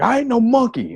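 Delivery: high-pitched mock indignant protest; mad. Offended audience member answers Paul Mooney, mock mad. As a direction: imitate an offended man, voice shooting up. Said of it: "this dude got mad".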